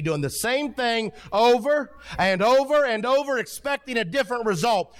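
high, driving pulpit declaration; impassioned preaching. Acted. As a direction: preach it high, stretching the last line. Preaching at full force.

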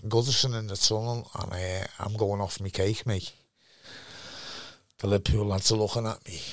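usual speaking voice

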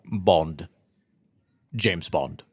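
put-on low Sean Connery Scottish accent as Bond. Asked for a Scottish accent, a low Connery-style Scottish accent. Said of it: "Who can do their best Scottish accent".

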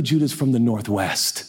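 ordinary delivery

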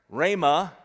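"Rhema" called out loud. Shown as a demonstration. Preacher drills the room, calling "Rhema" loud. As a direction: congregation answer, "Rhema" shouted. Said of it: "word drill".

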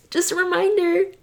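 words stretched through a yawn. Real, not acted. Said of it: "I got a yawn".

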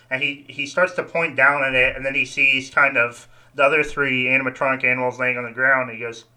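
ordinary talk, nothing marked

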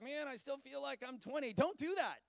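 normal talking voice

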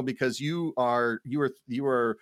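usual speaking voice

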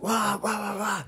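high, soft mocking wah-wahs; mock wah-wah blabber. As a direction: imitate someone's angry blabber as whiny noise. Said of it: "he's like".

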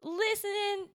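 high, slow, halting Cockney attempt; hesitant amateur Cockney; attempted Cockney accent. Shown as a demonstration. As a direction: feel your way through "listening" in Cockney. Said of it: "no syllables I can take".

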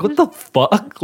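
high, startled what the fuck; baffled shout. Retold or imitated. Husband retelling seeing wife ahead of him. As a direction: react in disbelief. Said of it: "I was like".

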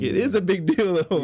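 high-pitched contradiction, retold; insistent retort. Arguing with his brother about the danger, insistent. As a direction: push back hard, voice jumping up high.

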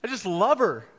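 high, loud, tearful laughing through tears; choked-up laugh, overwhelmed with love; father telling his wife nothing is wrong, laughing through tears; retold crying. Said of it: "I start crying".

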